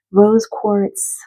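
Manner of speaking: ordinary delivery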